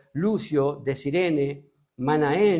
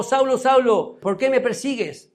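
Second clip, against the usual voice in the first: calling out; 7 semitones higher, 4 dB louder than usual. Acted. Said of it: "Llamándolo".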